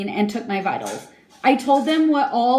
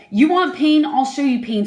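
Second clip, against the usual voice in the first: retold yell; 4 semitones higher, 5 dB louder than usual. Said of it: "he was yelling this in my face".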